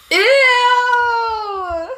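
long, high squealed noise, sliding down; high comic sound effect, slowly falling; imitated noise. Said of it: "when I hear this".

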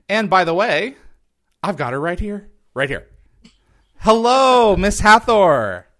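loud, high announcer-style greeting; grand welcome for a star guest; showman welcome. Acted. Host greets guest theatrically.

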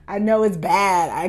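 normal talking voice

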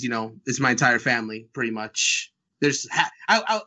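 ordinary delivery

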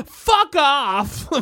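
high, loud cut-in; comic yelled brush-off; mock outburst. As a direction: explode over the chatter, hammed up. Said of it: "They're like".

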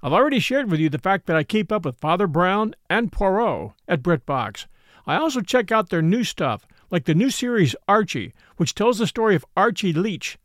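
upbeat, measured promo read; sponsor ad read. Acted. Host pitching BritBox to listeners, sponsor ad read.

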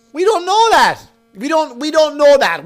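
high, exasperated; indignant. Real, not acted. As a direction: insist it, pitched high. Indignant rant.

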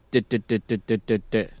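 monotone imitation of a dry narrator; strictly informational. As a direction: tick off identical, evenly spaced syllables like a machine. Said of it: "It's just strictly informational".

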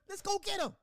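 high hammed-up war cheer; mock battle cry. Mocking eager recruits shouting to go fight, hammed up. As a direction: cheer them off to war, over the top.

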